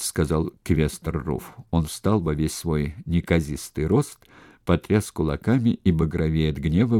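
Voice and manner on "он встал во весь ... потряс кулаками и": ordinary delivery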